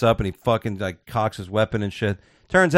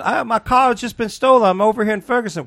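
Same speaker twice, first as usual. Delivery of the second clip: mock caller; 10 semitones higher than usual. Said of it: "She goes".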